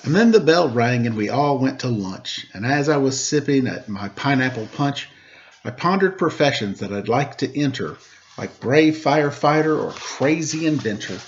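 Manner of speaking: lively rhymed storybook reading; reading a story to children. A narrator's reading. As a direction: read the rhyming story aloud to the kids. Reads a rhymed picture book aloud.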